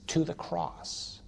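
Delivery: soft, breaking whisper at a grave; hushed old veteran. Retold or imitated. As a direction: speak softly to the dead. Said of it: "And he says".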